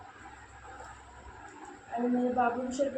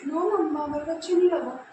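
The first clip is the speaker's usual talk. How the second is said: put-on higher, louder childlike reply; voicing the baby; 5 semitones higher, 6 dB louder than usual. Mother speaking for her baby about the room, childlike voice. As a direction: answer as the pouting little girl. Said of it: "आपको अच्छा लगा?".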